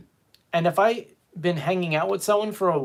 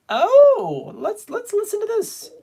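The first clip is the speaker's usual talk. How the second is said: much higher, bursting with excitement; excited squeal; 5 dB louder, slower than usual. Real, not acted. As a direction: squeal like your favorite song just came on. Excited [name] clip announcement.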